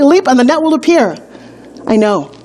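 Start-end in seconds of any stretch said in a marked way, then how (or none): 0.0-1.2 "Leap" lifted higher and punched like a motto; slogan read out. Shown as a demonstration. Coach quotes her motivational posts, "Leap" stressed high. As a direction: proclaim "Leap and the net will appear" as a banner slogan. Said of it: "little posts that say".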